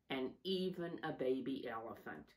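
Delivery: plain reading voice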